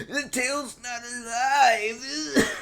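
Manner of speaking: high, slow, mocking whine of an offended fan; mock angry fan. Fans yelling at the creator, a high, slow mock whine. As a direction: drag it out like a huffy fan. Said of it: "D-Man nineteen fifty-four fans come at me and say".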